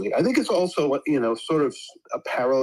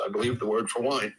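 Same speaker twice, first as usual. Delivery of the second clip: ordinary talk, nothing marked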